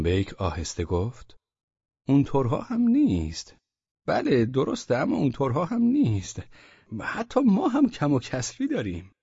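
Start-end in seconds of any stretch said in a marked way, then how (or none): 2.1-9.1 a bureaucrat covering his tracks; flustered official. Acted. Osman Bey backpedals to the merchants in a high, flustered official voice.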